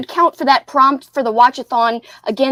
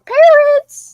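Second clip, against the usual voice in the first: high call; 14 semitones higher, 6 dB louder than usual. Pretend. Mock call for the parrots.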